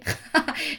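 short, high mock laugh in his face; imitated mocking laugh. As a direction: imitate mum laughing at him. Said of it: "como en su cara de esta risa".

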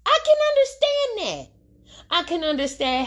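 pitched far up, held "I can understand that"; squeaky emphatic concession; emphatic high agreement. Real, not acted. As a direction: stretch it high and insistent.

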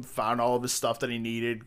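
usual speaking voice

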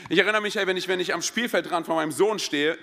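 ordinary talk

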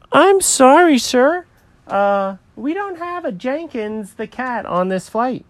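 high-pitched, apologetic airline clerk; check-in lady, apologetic. Acted. Airline lady tells the cat he is not booked, high and apologetic. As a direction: break bad news as a high-voiced clerk.

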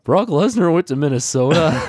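high, blurted mock-panicked question. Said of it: "panicked and was like".

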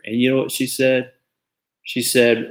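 ordinary delivery

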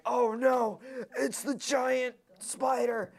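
high, drawn-out pretend alarm; mock dread. Husband trapped downstairs with spider, mock dread. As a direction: ham up the terror slowly.

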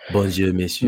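hammed-up French greeting; mock French greeting to a new acquaintance. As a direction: put on a jokey French accent. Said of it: "they be like".

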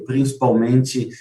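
ordinary talk

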